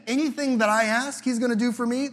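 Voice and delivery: thrilled at the promise; lightly mocking glee; mock excitement. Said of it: "he says it again".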